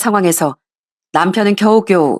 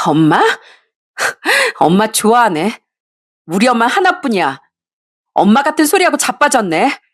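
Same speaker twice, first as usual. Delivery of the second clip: scornful mockery; 3 semitones higher than usual. Daughter mocks mistress.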